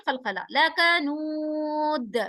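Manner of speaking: लकनूद ending with a jerking echo on द; लकनूद with a jerky bouncing दाल; qalqala on dal. Shown as a demonstration. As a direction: stop on द and let it bounce. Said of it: "jerking sound के साथ".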